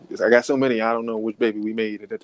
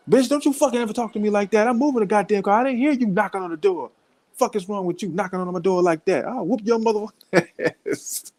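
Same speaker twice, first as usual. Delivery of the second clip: much higher, heated cursing; mock tirade; 8 semitones higher than usual. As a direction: rant like the furious sister. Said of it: "So she went down the steps".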